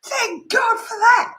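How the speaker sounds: loud, high, drawn-out squeal; squealed thanks, hugely relieved; gleeful relief. Acted. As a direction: celebrate it slowly at the top of your voice. Relieved the neighbour is leaving.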